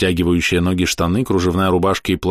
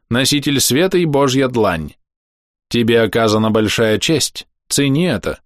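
solemn voice; 5 semitones higher than usual. Acted. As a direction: proclaim the emperor's titles grandly. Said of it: "торжественным голосом начал".

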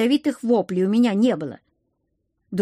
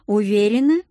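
mildly icy, louder question; 5 dB louder than usual. A narrator's reading. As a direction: ask with a touch of ice. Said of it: "прозвенел льдинками вопрос". (с лёгким холодком)

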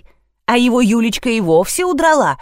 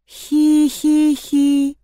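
high, slow monotone "hee-hee-hee"; eerie toy giggle; 5 semitones higher, much slower than usual. A narrator's reading. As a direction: giggle like a spooky toy, drawn out. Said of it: "Тихо, но отчётливо прошелестело". (жуткое протяжное хихиканье)

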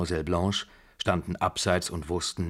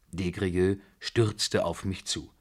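plain reading voice

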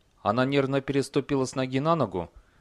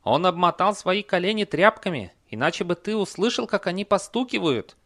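high-pitched horse character voice; explaining horse; 8 semitones higher, 3 dB louder than usual. A narrator's reading. Horse explaining trick to troll. (высокий голос лошади)

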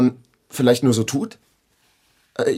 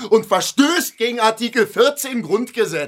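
hoch und laut, getragen deklamiert; Schreien; 9 semitones higher than usual. Acted. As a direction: predige es wie eine Paragraphenrede, immer höher. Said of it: "Du schreist in meiner Wohnung". (laut deklamierend, höher steigend)

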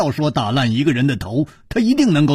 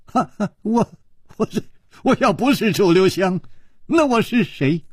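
high, halting rueful protest; wry laugh; 6 semitones higher than usual. A narrator's reading. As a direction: stammer with a forced little laugh. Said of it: "楚留香苦笑着说". (苦笑着结结巴巴地辩解)

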